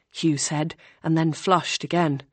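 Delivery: plain reading voice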